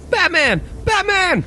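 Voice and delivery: high, urgent cry for a friend; horrified call. Acted. Robin calls for Batman after the explosion, high cry.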